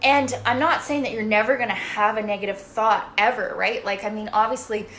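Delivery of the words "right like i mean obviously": ordinary talk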